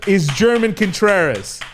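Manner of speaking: booming podcast host introducing the show; grand host intro. Acted. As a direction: announce yourself like a big showman.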